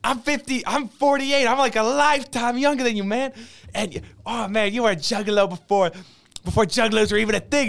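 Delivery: high-pitched hammed-up shout-out; imitated over-the-top celebrity video message. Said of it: "I'm probably gonna explain this horribly".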